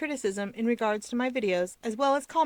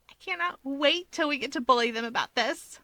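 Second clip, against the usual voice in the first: grinning; 6 semitones higher than usual. A narrator's reading. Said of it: "Rika grins".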